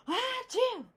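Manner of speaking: loud high sneeze; bigger sneeze. Acted. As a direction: let out a huge sneeze. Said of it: "sneezing an even bigger sneeze".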